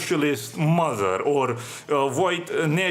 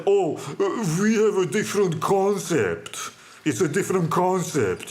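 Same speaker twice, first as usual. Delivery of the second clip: mocking precious clerk; 3 semitones higher than usual. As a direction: imitate a precious, self-important clerk, mildly mocking. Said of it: "he answered".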